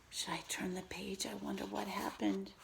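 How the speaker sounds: hushed suspense whisper. Acted. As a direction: lean in and whisper the question. Hushed suspense while reading.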